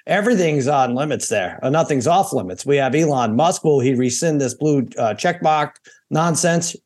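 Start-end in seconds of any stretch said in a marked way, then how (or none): none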